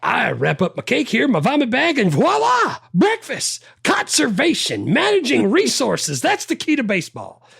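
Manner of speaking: high, proud demonstrating voice; Brickma voice. Retold or imitated. Quoting Brickma's breakfast speech in a high voice. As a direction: climb high on voila and breakfast. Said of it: "the last thing that I had was".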